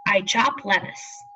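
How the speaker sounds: ordinary talk, nothing marked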